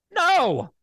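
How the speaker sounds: high, sharp, emphatic NO; insistent refusal. Real, not acted. Host tells spineless commissioners to refuse, insistent high refusal. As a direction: punch "No" high as an outburst. Demands they say the word no.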